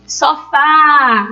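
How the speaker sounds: sofá with FÁ drawn out long; calling out the word. Shown as a demonstration. Teacher showing the última is tônica, calling sofá with long FÁ. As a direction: call sofá, pulling on the final FÁ. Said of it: "eu vejo que puxa mais a tônica".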